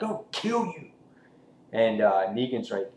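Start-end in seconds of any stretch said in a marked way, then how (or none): 0.0-0.9 high, soft mock threat; a hushed high put-on threat; Dwight threat.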